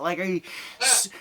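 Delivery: ordinary talk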